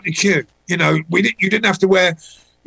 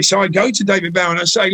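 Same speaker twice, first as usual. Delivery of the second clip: ordinary talk, nothing marked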